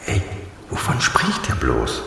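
slow, hushed thinking aloud; puzzled aside. Acted. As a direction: whisper it to yourself, baffled. Puzzled inner thought during the call.

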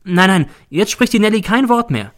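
high, loud and flustered refusal; frightened woman backing away; Nelly alarmed. A narrator's reading. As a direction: shut the conversation down fast.

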